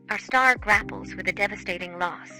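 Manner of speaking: ordinary delivery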